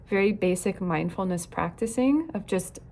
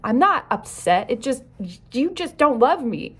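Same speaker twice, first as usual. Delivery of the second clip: fast, louder, defensive protest; defensive child; 3 semitones higher, 4 dB louder than usual. Retold or imitated. Coach imitating a defensive child brushing off a parent, hurt. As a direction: push back hard, hurt underneath. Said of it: "So they might say".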